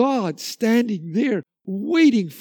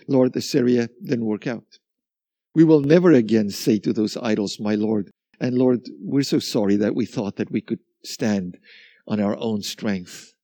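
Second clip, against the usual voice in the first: lower, humbled confession; low penitent prayer; 8 semitones lower than usual. Retold or imitated. The people confessing to the Lord that they were wrong. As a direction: lay it down low and contrite. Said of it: "they come and they say".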